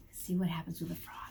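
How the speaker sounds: ordinary delivery, nothing marked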